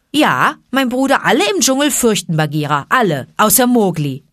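lightly voiced proud panther. A narrator's reading. Bagheera voiced boasting.

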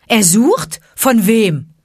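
loud, high, sharp retort; calling out. A narrator's reading. Mowgli cuts into Shere Khan's speech, calling out. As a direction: throw the question back as a challenge. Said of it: "rief Mowgli".

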